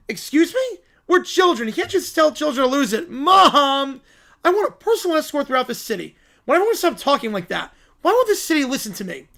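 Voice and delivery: high, whiny outrage; indignant child. Acted. Child scolding a taxi driver, high indignant whine. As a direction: protest like an offended kid calling for mom.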